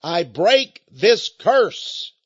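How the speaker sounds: very slow, weighty declaration; solemn slow proclamation; declaring. Retold or imitated. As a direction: spell out each word like breaking a curse. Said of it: "she shouted".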